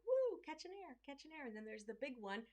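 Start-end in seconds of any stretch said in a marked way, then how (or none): 0.1-1.5 excited woo. Retold or imitated. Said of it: "they're like".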